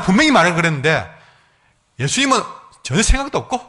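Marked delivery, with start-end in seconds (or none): none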